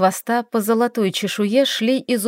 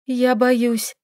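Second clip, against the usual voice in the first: frightened; 3 semitones higher than usual. A narrator's reading. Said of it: "дрожащими губами повторила она".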